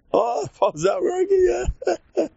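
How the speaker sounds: very high, laughing exclamation; squealing mock-hilarity over a mishap; cracking up. Shown as a demonstration. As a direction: laugh through the words, voice shooting up. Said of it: "they crack up".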